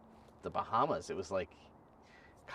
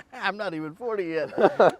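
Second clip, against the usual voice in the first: laughing through the words, high and loud; 10 semitones higher, 11 dB louder than usual. Real, not acted. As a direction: giggle while protesting. Laughing at joke about forty.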